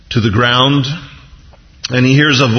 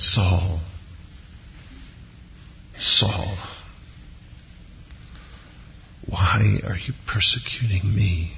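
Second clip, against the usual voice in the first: soft, heartbroken pleading; hushed, sorrowful appeal with long pauses; pathos and heartbreak; 9 dB quieter, slower than usual. Acted. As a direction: say it gently, as if deeply wounded. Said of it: "there's a pathos and a heartbreak to it".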